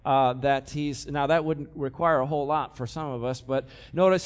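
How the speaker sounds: usual speaking voice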